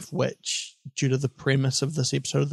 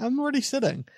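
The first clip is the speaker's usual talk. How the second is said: high whiny complaint; 10 semitones higher, faster than usual. Shown as a demonstration. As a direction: whine it, pitched way up. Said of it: "You know the feeling".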